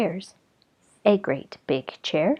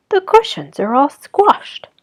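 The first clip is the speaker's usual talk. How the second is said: high, louder exclaim; indignant Mama Bear; 8 semitones higher, 6 dB louder than usual. Acted. Mama Bear finds cushions squashed, high and loud. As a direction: exclaim it with dismay.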